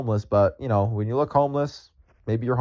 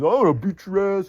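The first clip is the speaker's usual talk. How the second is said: high, slow imitation of a threat; mock threat; 8 semitones higher than usual. Angry customer threatens the ice cream seller, imitated threat. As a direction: imitate a man threatening violence, pitched up. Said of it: "starts to threaten me with violence".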